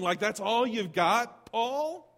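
incredulous rise on Paul, pitched high; hammed-up grumble aimed at Paul; mock indignant question. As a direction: whine it up at Paul, disbelieving.

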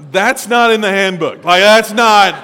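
loud, firm disapproval; stern correction. Retold or imitated. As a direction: set him straight. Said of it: "And I'm like".

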